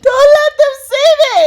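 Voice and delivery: put-on high, loud tearful child plea; voicing shy Cleo. Speaker voicing Cleo hiding from viewers, high and tearful. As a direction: cry out like a hiding toddler. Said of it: "voicing Cleo hiding".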